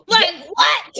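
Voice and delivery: 높고 크게 놀라 외침; outraged shout. Real, not acted. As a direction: shout it up high in disbelief. Outraged reaction to gaslighting.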